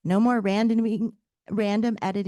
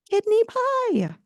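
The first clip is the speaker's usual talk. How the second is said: slow, much higher British voice; British accent; 13 semitones higher, slower than usual. Acted. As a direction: Atlas speaking in a put-on British accent, drawn out. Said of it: "he says with a faint British accent".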